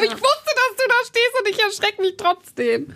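high, shocked, laughing voice; startled squeal. Retold or imitated. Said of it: "Und ich so".

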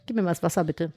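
froggy throat, clearing it; needs to clear throat. Real, not acted. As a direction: talk with a catch in the throat. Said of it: "Ich muss räuspern". (belegte Stimme, muss räuspern)

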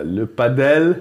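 slow, drawn-out French pa-DELL; mock French. Friend teasing about the French origin, mock French accent. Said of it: "das kommt aus Frankreich".

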